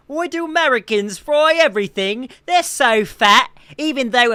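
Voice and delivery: loud, high mock-British whine; mock British complainer. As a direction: pitch it up and exaggerate an outraged Brit. Mocks British people complaining about Americans.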